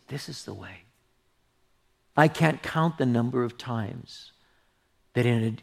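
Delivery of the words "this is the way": soft, hushed guidance; voice of God. Retold or imitated. God guiding the reader of his word, hushed. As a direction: murmur it low and soft. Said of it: "he'll say".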